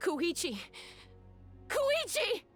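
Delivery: high cry, climbing sharply on the repeat; desperate calling. Acted. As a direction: call the name in rising panic. Calling out for lost sibling.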